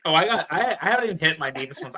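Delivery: ordinary delivery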